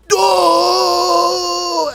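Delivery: high, long held mock yell; cartoon fighter wailing as he's sent flying; drawn-out, high-pitched "duh" cry; imitated knockback cry.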